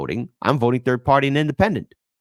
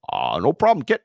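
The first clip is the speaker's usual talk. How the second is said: Obama impression. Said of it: "Take it away, Obama".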